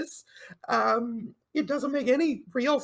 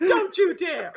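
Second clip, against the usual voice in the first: higher, louder playful scolding; mock outrage; 5 semitones higher, 6 dB louder than usual. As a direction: scold your co-host in pretend offence.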